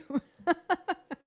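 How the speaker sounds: bursting out laughing, high and loud; amused laughter; real laugh. Real, not acted. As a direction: laugh helplessly at the joke. Still laughing after Peter's joke.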